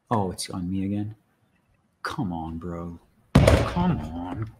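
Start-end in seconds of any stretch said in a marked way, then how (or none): 2.0-3.0 low, restrained plea starting soft; low plea beginning to build; low urging. Real, not acted. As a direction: urge the game on, holding back. Pleading with frozen game.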